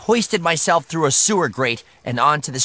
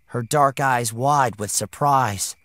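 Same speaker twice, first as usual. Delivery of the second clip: ordinary delivery, nothing marked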